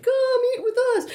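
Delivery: high, far-off beckoning call; restaurant caller. Retold or imitated. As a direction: call diners in from the door. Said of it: "the people stand outside".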